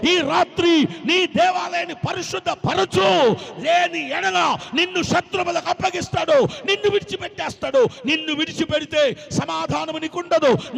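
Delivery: loud, high, driving altar call; preaching at full force. Acted. Preacher urging the church to cleanse their hearts tonight, shouting. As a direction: shout each line as a summons to repent.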